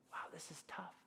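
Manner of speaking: unvoiced whisper, daunted; softly whispered wow, this is tough; whispered dismay. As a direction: whisper it like the weight just hit you. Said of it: "I'm like".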